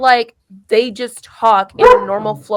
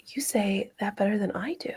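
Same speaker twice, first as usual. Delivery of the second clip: whispered quote; 13 dB quieter than usual. Retold or imitated. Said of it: "she's like".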